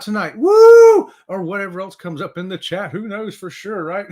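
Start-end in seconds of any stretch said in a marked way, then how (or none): 0.4-1.1 loud, high whoop of hype; excited whoop. Real, not acted. Host whooping to pump up live viewers, loud and high. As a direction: let out a big whoop to pump up the room. Host hypes up the show topic.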